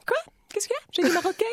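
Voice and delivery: bien plus aigu, faussement étonnée; mock surprise. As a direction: jouer l'innocente surprise. Character feigns surprise. (bourgeoise surprise par sa propre gaffe, faussement étonnée)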